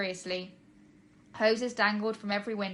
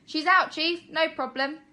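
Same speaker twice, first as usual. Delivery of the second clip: higher, louder cheerful shout; 8 semitones higher, 8 dB louder than usual. Acted. Fireman shouts to his chief, cheerful and loud. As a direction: call it across the room. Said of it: "one of the firemen shouted".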